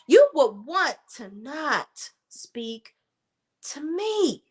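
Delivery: "You will want to not speak" slowed right down, word by word; slow repeat. Shown as a demonstration. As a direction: spell it out slowly, as a warning.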